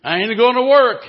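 loud, high defiant cry; defiant repeat. Acted. Slave repeating to the bidders he won't work, loud defiance. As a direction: keep hammering the refusal. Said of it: "kept saying over and over".

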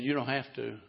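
soft, low gentle answer; calm him quietly; gentle reply. Acted. New owner answering the defiant slave, soft and gentle. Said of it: "the man looked at him and said".

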